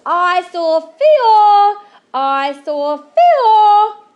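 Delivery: put-on slow, high, stretched-out child's yell; excited yelling. A narrator's reading. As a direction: call it out gleefully, drawing each word out. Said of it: "she yelled".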